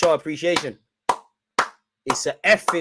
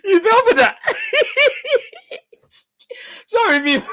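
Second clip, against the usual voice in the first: high, loud squeal through real laughter; helpless laughter; 13 semitones higher, 5 dB louder than usual. Real, not acted. Streamer mocking a gullible commenter, laughing helplessly. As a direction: crack up so hard the words come out high and squealed.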